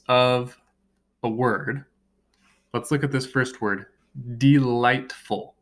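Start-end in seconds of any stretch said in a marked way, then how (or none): 4.4-5.5 delightful split into de-light-ful, drawn out; delightful broken into three slow, separate beats; syllable by syllable. Shown as a demonstration. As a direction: say DE-LIGHT-FUL slowly, one beat per syllable. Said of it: "clap out the sounds".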